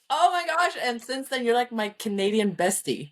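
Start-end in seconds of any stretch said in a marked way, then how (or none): 0.1-0.8 high, excited oh my gosh; squealing delight, retold; thrilled gasp. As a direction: gush it like you can't believe it. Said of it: "And I was like".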